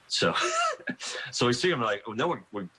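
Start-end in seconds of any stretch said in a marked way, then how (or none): 0.4-1.3 a short, high burst of embarrassed laughter; quick high laugh. Real, not acted. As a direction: laugh at your own blunder. Coach recalls embarrassing recruiting miss.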